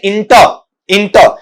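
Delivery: इंटर starting with an i, "IN-ta"; short i opening on इंटर; common mispronunciation. Shown as a demonstration. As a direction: say इंटर with "in" at the front. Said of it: "अब गलती क्या होती है".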